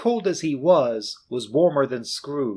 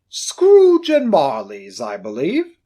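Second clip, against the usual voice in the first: louder, slow, booming greeting; hearty gentleman; 9 dB louder, slower than usual. Acted. Portly gentleman greeting Scrooge, booming. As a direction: announce yourself pleasantly.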